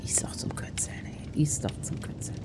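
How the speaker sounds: unvoiced whispered grumble; whispered complaint. Real, not acted. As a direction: hiss it under your breath.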